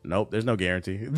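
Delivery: ordinary delivery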